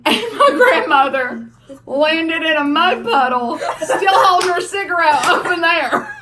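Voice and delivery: can barely get it out for laughing. Real, not acted. Laughing while telling story.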